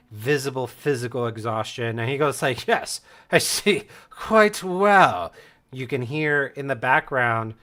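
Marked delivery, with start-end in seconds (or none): 2.7-5.3 slow, higher and louder appraisal; slow, pointed acknowledgement; battle master. Acted. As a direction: answer as a commanding battle master, drawn out. Battle master eyes the battered students.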